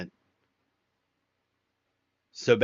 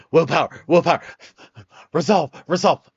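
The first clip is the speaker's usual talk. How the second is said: loud, higher-pitched repeated bursts; straining for self-control; 4 semitones higher, 7 dB louder, faster than usual. Acted. Streamer acting out the fight against giving in, loud strained bursts. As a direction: chant it urgently, willing yourself to resist. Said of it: "try so hard not to give in".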